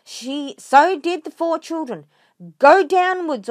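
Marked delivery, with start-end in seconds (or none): 2.6-3.5 high, loud commanding bark; urgent order. Acted. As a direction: order the family to dig down. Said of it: "ordered Mister Fox".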